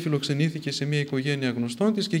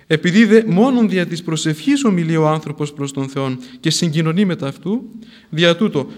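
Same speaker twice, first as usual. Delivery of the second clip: louder sermon voice; fervent sermon teaching, louder; preaching; 6 dB louder than usual. Acted. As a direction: proclaim it from the pulpit. Expounds worship at full force.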